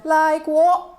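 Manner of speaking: mock British, with a questioning lift; British accent. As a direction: answer in a badly faked British accent. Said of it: "felt the need to speak with a British accent".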